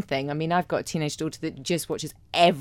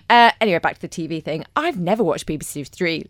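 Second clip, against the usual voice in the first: ordinary talk, nothing marked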